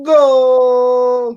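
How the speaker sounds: high, loud drawn-out goal cry; celebratory yell. Acted. Host mocking a touchdown by shouting goal, celebratory yell. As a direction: yell it long like a soccer announcer. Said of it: "I yell".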